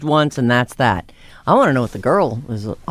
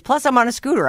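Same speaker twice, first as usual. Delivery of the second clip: usual speaking voice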